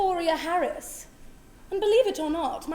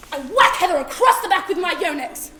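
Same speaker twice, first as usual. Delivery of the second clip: much louder, higher, manic outburst; frantic yelling; 3 semitones higher, 10 dB louder than usual. Acted. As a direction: yell while swinging the racket. Attacking the cockroach.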